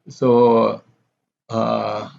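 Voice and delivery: ordinary delivery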